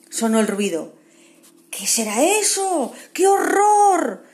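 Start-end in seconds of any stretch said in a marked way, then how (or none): 1.7-4.2 high, loud, slow cry of horror; frightened cry. Acted. Kevin hears a terrible knocking at night, terrified. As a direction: panic at a noise in the night.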